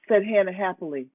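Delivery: usual speaking voice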